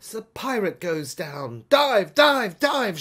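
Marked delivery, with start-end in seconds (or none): 1.7-3.0 loud high urgent call; repeated shouted command. A narrator's reading. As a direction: yell it down to the diver. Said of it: "she called".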